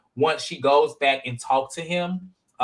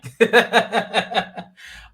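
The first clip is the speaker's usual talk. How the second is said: bright burst of real laughter; 7 semitones higher, 5 dB louder than usual. Real, not acted. Laughing at a co-host's joke, real laughter.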